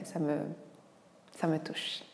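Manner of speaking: whispered, as if deeply moved; mock touched whisper. Doctor teasing a nosy viewer, mock touched whisper. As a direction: breathe it out in a soft teasing whisper. Mock thanks for marriage question.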